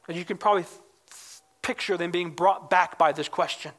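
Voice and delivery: ordinary talk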